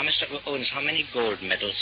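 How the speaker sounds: normal talking voice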